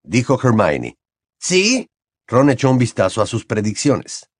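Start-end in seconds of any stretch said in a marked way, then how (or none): 1.4-1.9 put-on a lightly raised boyish voice; Ron's voice. A narrator's reading. Ron answers Hermione in a light boyish voice. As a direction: play the cheeky teenage boy.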